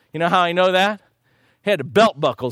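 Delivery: ordinary delivery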